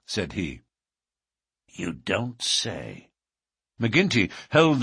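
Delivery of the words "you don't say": very slow, impressed reply; drawn-out amazement. Acted. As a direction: stretch it out, weighing the surprise. McGinty impressed by the coins.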